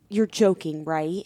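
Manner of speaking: retold in a low, soft, disbelieving voice; low dismayed mutter. Embarrassed wife to husband at the petting zoo, hushed disbelief. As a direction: quietly plead that this is a joke.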